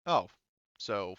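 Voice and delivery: ordinary talk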